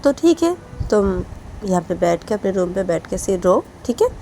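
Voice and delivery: angry scolding mother. Acted. Mother scolds crying daughter.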